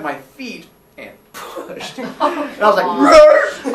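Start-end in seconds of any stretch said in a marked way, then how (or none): 2.9-3.7 loud high strained growl; struggling growl. Retold or imitated. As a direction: kid pushed into the crawl space, loud strained growl. Being pushed by feet.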